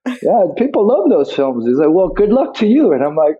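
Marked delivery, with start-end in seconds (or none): 0.2-1.7 casual Cockney, pitched up; Cockney accent. Retold or imitated. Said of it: "his, you know, Cockney". 1.9-2.9 put-on Cockney accent. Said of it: "his, you know, Cockney".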